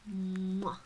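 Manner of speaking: a loud smacking air kiss; kiss sound. Acted. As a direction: blow the pet a fond kiss. Witch kisses her pet.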